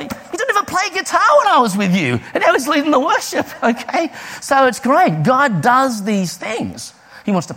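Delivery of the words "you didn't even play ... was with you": incredulous. Retold or imitated. Astonished at friend leading worship.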